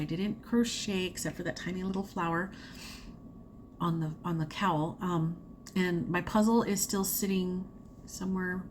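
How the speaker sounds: normal talking voice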